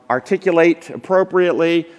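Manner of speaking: normal talking voice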